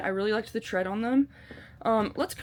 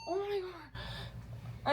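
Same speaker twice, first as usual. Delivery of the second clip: breathless laugh; 9 semitones higher, much slower than usual. Real, not acted. Hiker laughing at feeling cool with her mug. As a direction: let it die out on a laugh.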